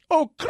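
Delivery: high rolled tongue trill; hammed-up high trilling noise; mock trilled catchphrase. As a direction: mimic the slang with a long rolled trill. Mimics the bulletin slang again.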